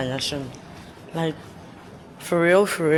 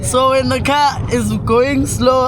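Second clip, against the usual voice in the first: excited; 10 semitones higher, 6 dB louder than usual. Real, not acted. Complaining to driver, laughing.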